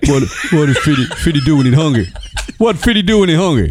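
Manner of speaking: hammed-up American rap star accent, fitty for fifty; lightly voiced [name] accent, laughing through it; mock [name] accent. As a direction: put on a loose [name] accent, dropping the f in fifty. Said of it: "say it with his accent".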